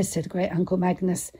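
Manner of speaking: usual speaking voice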